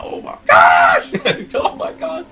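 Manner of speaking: drawn-out high shriek, then laughing; crazy fanboying out. Real, not acted. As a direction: scream it high and long, break into laughter. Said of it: "I am fanboying out like crazy".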